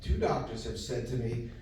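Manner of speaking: normal talking voice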